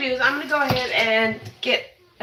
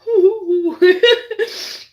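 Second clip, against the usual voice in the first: teary laugh; 9 semitones higher, 4 dB louder than usual. Real, not acted. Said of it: "he's pretty strong".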